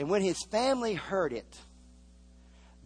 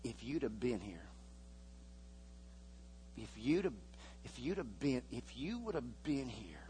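much softer, halting and wounded; hurt, quiet reproach; 4 semitones lower, 11 dB quieter than usual. Shown as a demonstration. Mary and Martha reproaching Jesus, quiet and hurt. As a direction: say it like a grieving sister stumbling over her words. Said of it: "Let me tell you how they really said it".

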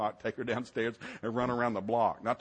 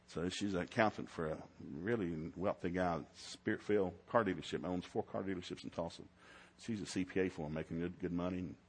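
ordinary talk, nothing marked

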